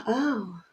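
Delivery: taking a breath. Retold or imitated. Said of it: "I just took a breath".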